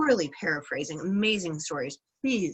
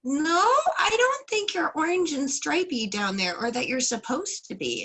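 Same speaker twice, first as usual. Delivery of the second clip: doubting villagers; 9 semitones higher than usual. Retold or imitated. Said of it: "And they're like".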